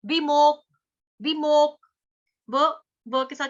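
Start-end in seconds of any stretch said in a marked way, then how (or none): 0.0-1.9 विमोक with a sharp push on मो, louder; stressed विमोक. Shown as a demonstration. Teacher drilling the syllable for students, loud. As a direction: hit the मो in विमोक. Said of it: "बोलना है विमोक".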